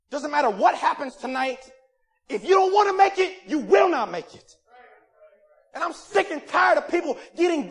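loud, high, fiery sermon; fiery preaching. Acted. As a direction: preach it hard, building up. Preaching at full force.

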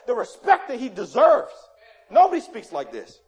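loud, awestruck marveling; acted amazement. Astonished listeners reacting to God speaking, excited and amazed. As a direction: build from hushed disbelief to loud wonder. Acting the astonished crowd hearing Jesus.

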